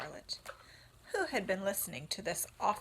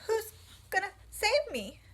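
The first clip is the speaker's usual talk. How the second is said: much higher, louder, crying; tearful piglet; 12 semitones higher, 5 dB louder than usual. Acted. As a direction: sob the question. Wilbur sobbing question.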